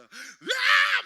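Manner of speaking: high, full-force rock scream; explosive high-pitched yell, acted out; rocker shouting. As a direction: imitate a mother screaming like a rocker. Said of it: "막 샤우팅을 하고 나서".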